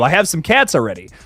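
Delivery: ordinary talk, nothing marked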